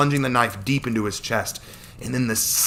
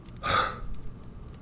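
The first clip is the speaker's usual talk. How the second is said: long unvoiced sigh; 7 dB quieter than usual. Man calming himself.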